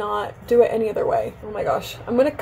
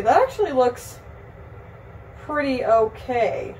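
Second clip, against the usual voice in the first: ordinary talk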